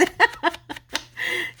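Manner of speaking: real high-pitched laugh. Real, not acted. Quoting her husband, real high laugh.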